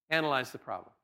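softly repeating analyze the problem; quiet repeat. Shown as a demonstration. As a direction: drop it down and let it sink in. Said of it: "You gotta analyze the problem".

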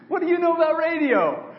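loud and high, can't believe the idea; incredulous outburst. Retold or imitated. Preacher reacting to his friend's radio plan, loud incredulous laugh-edged question. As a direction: blurt it out in amused disbelief.

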